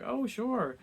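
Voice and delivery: high, sing-song oh sure; fake friendly agreement. The miller fake-agreeing with the students. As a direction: sweetly pretend to agree.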